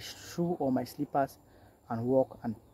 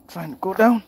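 louder, higher call urging himself on; raised urging call; 7 semitones higher, 12 dB louder than usual. Real, not acted. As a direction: call it out as a rising shout. Repeats his own call louder.

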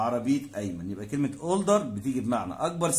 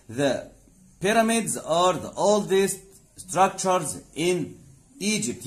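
slow teaching read; 3 semitones higher, much slower than usual. Shown as a demonstration. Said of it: "teacher reads English example".

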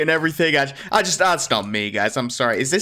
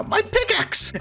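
shocked cry; slower than usual. Real, not acted. Losing his pickaxe.

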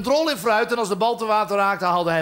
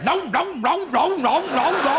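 repeated nou, pitched higher and rising like a weary sigh; hammed-up Petrus, fed up and playing along; higher, sing-song nou nou of mock despair; mock exasperated; 6 semitones higher, slower than usual. Petrus answering Jesus, mock-exasperated.

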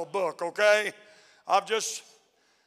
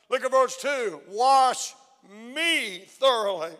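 scripture reading. Acted. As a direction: linger on each line of the psalm. Reading psalm aloud.

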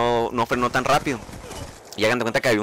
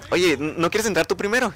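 imitating a nervous girl, higher pitch; 6 semitones higher than usual. Nervous classmate asks him to go first, imitated nervousness. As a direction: lightly voice her nerves, pitched up. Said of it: "ella se puso nerviosa y me dijo".

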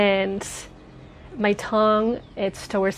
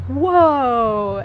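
surprised whoa; 3 semitones higher, 6 dB louder than usual. Retold or imitated. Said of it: "I was like".

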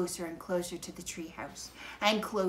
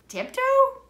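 surprised; 12 dB louder than usual. Acted. Annie disbelieving Jack's plan.

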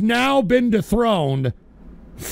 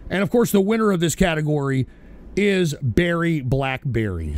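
normal talking voice

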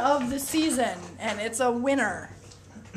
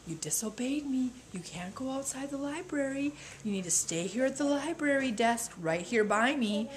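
hushed, calm and firm; calm whisper; 9 dB quieter than usual. Retold or imitated. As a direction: whisper it calmly to a child. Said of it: "I very calmly tell them".

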